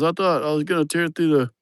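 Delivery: light mock Mexican accent; retelling the worker's fear of the bull in a Mexican accent. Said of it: "And he goes".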